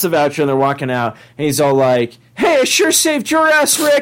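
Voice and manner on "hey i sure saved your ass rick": very high cartoon boy impression; Morty. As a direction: put on a high kid's voice. Acting Morty addressing Rick.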